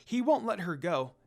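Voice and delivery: usual speaking voice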